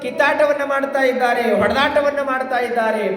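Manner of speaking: high, sustained discourse intoning; declamatory preaching. Acted. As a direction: declaim it high like a devotional storyteller. (ಏರುದನಿಯ ಪ್ರವಚನ ಶೈಲಿ)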